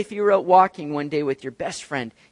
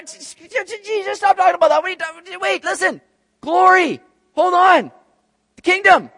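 high, loud, brushing Jesus off; impatient disciples; 13 semitones higher, 10 dB louder than usual. Retold or imitated. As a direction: interrupt him to talk about glory.

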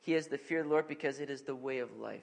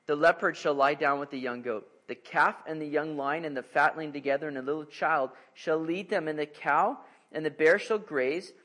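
louder, ringing Bible reading; scripture reading; 6 dB louder than usual. Acted. Pastor reading the prophecy aloud, ringing proclamation. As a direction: proclaim the passage to the congregation. Reads long scripture passage.

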